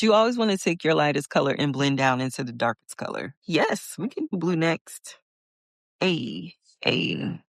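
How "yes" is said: ordinary talk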